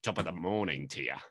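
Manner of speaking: bad Irish accent impression; jokey Irish accent line; mock Irish accent. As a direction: put on a bad Irish accent for Judi Dench. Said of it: "deep Irish accent".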